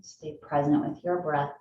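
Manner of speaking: calm, low-key meditation voice, long pauses; unhurried phrases, each left to settle; guided meditation. Acted. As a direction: guide them gently into the breath.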